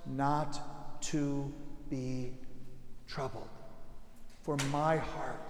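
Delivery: hushed appeal. Real, not acted. Preacher inviting listeners to let Jesus in, hushed. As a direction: draw it out almost to a whisper.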